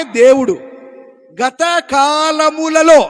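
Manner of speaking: slow, loud, drawn-out preaching; loud slow pulpit reading; slow scripture reading. Acted. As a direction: read each word slowly and loudly. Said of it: "preacher slows to read scripture".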